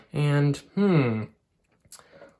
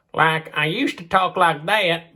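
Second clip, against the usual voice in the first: puppet voice change; 3 dB louder than usual. Shown as a demonstration. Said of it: "you can change our voices at any moment".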